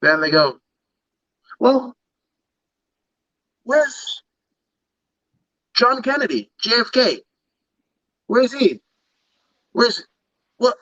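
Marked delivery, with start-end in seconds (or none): 1.6-10.8 much higher, incredulous divine outrage; God in mock disbelief, pitch climbing very high; playing God. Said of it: "he's playing God".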